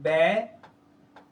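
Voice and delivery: Battery stretched very slowly, falling into a rise; slow drawn-out word. Shown as a demonstration. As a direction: spell out battery by ear, very slowly. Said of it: "battery বানান হচ্ছে". (ধীরে টেনে উচ্চারণ)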